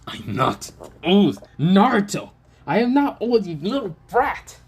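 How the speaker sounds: put-on snapping back at being called old; offended old man. Hiruzen scolding Naruto for calling him old, indignant. Hiruzen insulted about his age.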